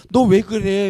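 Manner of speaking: loud, mock-reproachful parent; scolding parent. Parent reacting to an obedient child, loud mock scolding. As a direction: challenge the child in a loud burst. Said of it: "뭐라 그러시지?".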